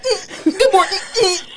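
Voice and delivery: high, tight little closed-mouth hum; mock polite greeting. Nurse mocking the toothless smile at coworkers, fake-polite. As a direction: imitate a stupid forced smile. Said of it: "the little stupid, like, smile".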